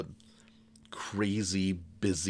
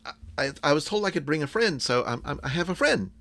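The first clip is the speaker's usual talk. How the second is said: put-on high-pitched, hesitant young girl; nervous kid speaking up; shy girl character; 7 semitones higher than usual. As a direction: stammer upward in a timid, high voice. Shy girl introduced by game master.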